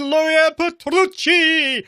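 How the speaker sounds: hammed-up Italian accent on the name, slow, rolled R; host rolling the guest's name in mock Italian accent. Shown as a demonstration. Mock Italian accent, slow and rolled. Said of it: "That's my best Italian accent".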